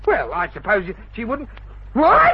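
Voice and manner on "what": loud high startled what; startled shout. Acted. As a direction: jump at it.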